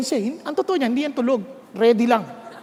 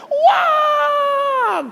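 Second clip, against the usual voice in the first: drawn-out, higher, crying shout; long anguished wail; 12 semitones higher than usual. Retold or imitated. Inner voice pleading with the angry husband, crying long shout. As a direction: stretch the no into a wail.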